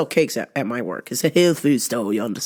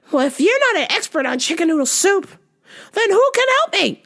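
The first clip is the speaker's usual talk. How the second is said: put-on much higher, fussy older lady on the phone; confused lady caller; 13 semitones higher than usual. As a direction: play the lost caller, pitch way up. Voicing the caller.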